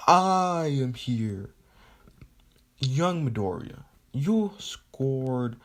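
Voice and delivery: big, drawn-out hero proclamation; booming hero entrance, stretched out; heroic announcer. Acted. Hero's recorded message acted out.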